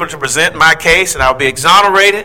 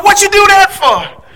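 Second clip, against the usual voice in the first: shocked cry; 4 dB louder than usual. Retold or imitated. Slapped child cries out.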